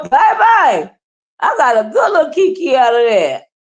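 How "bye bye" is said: sassy goodbye. Retold or imitated. Mocking dismissal.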